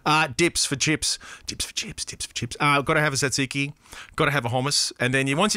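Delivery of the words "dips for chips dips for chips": whispered, repeating the phrase. Acted. Host whispering the listener's question to himself, whisper. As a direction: breathe "Dips for chips" with no voice.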